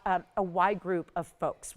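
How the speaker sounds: ordinary talk, nothing marked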